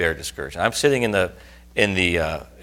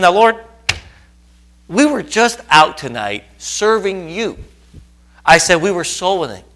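upset complaint to God; 9 semitones higher, 8 dB louder than usual. Retold or imitated. Preacher reenacting his complaint to the Lord, loud indignant pleading. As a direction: argue with God, hurt and indignant. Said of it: "then I got more upset".

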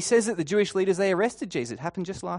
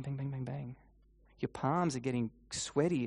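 usual speaking voice

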